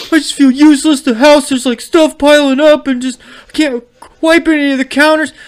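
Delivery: high, loud mock lament; imitated distressed wife. Sick wife upset about the messy house, mock distressed. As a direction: pitch it way up as a wife's complaint. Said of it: "My wife was like".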